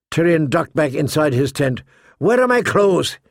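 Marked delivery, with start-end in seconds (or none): 2.2-3.2 higher, urgent demand; impatient bark. A narrator's reading. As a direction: bark it at a servant in haste. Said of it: "he barked at Shay".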